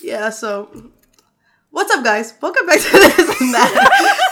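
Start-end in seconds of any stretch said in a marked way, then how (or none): 1.7-4.3 high, loud laughing redo of "Welcome back to This and That". Shown as a demonstration. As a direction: laugh through "This and That" on the retake. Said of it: "No, I did read it right".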